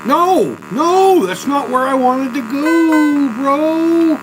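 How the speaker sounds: high, loud frustrated yell; raging gamer. Real, not acted. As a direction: scream it at the screen, slowly. Yelling at the game.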